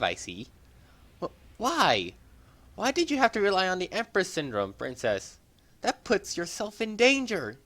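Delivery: high, stunned and pleading; shocked young hero. Acted. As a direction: disbelief turning into urgent reproach. Shocked hero confronting princess.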